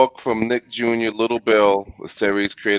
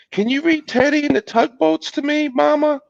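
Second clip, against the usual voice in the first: put-on high-pitched little boy; Little Bill's child voice. A narrator's reading. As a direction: ask Mama sweetly in a much higher child's voice. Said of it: "Little Bill asked".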